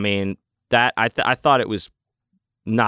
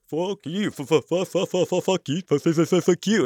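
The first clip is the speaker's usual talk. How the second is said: high sputtering imitation of a flustered opponent; pitched-up, ridiculing stutter; mocking stammered comeback; 9 semitones higher than usual. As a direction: mimic him stuck stuttering on a curse. Mocks other man's stammered comeback.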